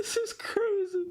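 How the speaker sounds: cracking up mid-word; high laughing squeal. Real, not acted. Laughing hard.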